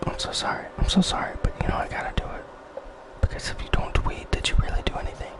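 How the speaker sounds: soft unvoiced whisper. Real, not acted. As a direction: hush it close to the mic. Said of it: "I had to, I had to whisper".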